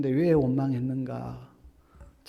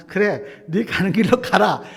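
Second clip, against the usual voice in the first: God letting go; 4 semitones higher, 9 dB louder than usual. Retold or imitated.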